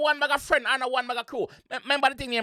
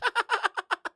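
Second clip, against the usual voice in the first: high, rapid cackle; mocking laugh; 7 semitones higher than usual. Real, not acted. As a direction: laugh it up at someone's expense. Laughs at the joke line.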